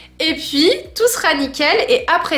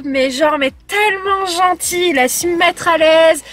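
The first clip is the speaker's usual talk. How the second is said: ordinary talk, nothing marked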